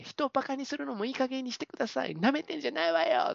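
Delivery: high, hammed-up female outrage; retold angry telling-off. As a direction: act out a drama heroine losing her temper. Acted quarrel, heroine telling someone off.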